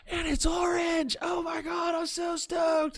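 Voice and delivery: put-on very high, slow, squealed kid voice; excited little kid. Man replaying the boy's joy at his orange room, high squeal. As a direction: gush like a thrilled nine-year-old.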